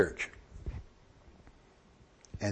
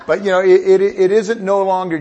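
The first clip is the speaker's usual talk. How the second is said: preaching shout; 7 semitones higher, 10 dB louder than usual. Real, not acted.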